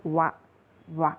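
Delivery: wa, the consonant sound sounded alone; isolated W sound. Shown as a demonstration. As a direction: say wa twice as separate short syllables. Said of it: "this W is pronounced as".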